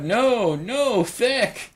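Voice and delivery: loud, high read-out of a viewer's protest; exaggerated pleading objection; mock protest. Reads viewer's protesting comment aloud.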